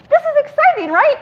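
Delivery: very high, eager retold squeal; giddy excitement. Woman coaxing her friend to share news, excited. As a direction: pitch it way up, seeking agreement.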